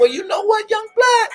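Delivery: imitating Ism. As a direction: lift way up into a teasing Ism voice. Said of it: "if I'm getting ism kinda saying".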